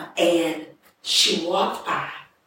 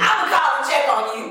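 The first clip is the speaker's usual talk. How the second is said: put-on fast, high drunken promise; drunk woman; 5 semitones higher, 4 dB louder, much faster than usual. Drunk woman promising to check on her, fast drunken holler. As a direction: imitate her drunk talk, rushed and raised. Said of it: "Drunk still. I'm not talking like she's talking".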